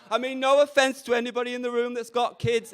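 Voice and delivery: usual speaking voice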